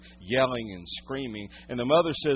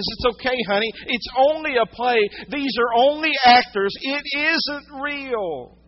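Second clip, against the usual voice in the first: high, loud motherly reassurance; insistent mother; 10 semitones higher, 6 dB louder than usual. Retold or imitated. Mother pleading with terrified child.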